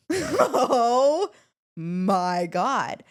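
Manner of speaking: laughing. Real, not acted. Laughing at mom's nagging.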